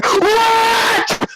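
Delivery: high shrieked outburst; furious cry at the drive-thru worker; outraged shriek. Retold or imitated. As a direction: shriek it in disbelief. Outraged at being told to park.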